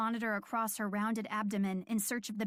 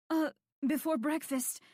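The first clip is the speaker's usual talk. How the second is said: breathless answer between contractions; laboring patient answers the nurse, slightly winded; catching her breath; 5 semitones higher than usual. Acted. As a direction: say it while winded from the pain. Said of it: "she responded as she worked to catch her breath".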